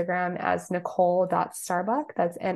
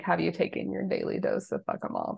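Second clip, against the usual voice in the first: normal talking voice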